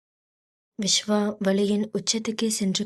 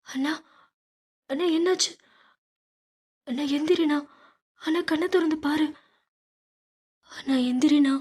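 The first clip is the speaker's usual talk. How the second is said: higher, tearful cries for her brother; wailing in tears; 7 semitones higher, faster than usual. A narrator's reading. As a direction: plead through tears, voice pitched up. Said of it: "Meera கண்ணீர் விட்டு கதறி".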